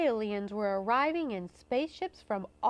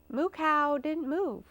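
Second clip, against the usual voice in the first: ordinary delivery, nothing marked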